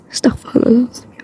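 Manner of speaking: a breathy prayer for patience under the breath; exasperated whispered muttering; whispered aside. Acted.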